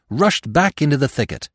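ordinary delivery

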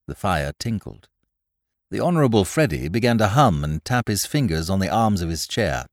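usual speaking voice

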